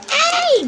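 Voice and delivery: very high loud shriek of delight; joyful shriek. Retold or imitated. Recreating her happy scream at a big order, shrieking high. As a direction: squeal ay with sudden joy. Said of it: "Napasigaw nga ako nun".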